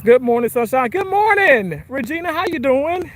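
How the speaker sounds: cheerful greeting. Real, not acted.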